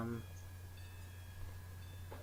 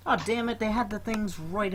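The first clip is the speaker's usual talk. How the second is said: sudden high frustrated cry; 10 semitones higher than usual. Real, not acted. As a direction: blurt it out in frustration. Frustrated at missing notes.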